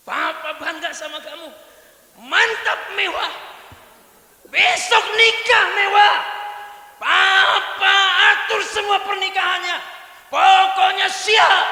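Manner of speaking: high, loud jubilant announcement; father proudly proclaiming the wedding; proud father shouting. Acted. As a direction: cheer it like the best news ever. Easygoing father proudly celebrates.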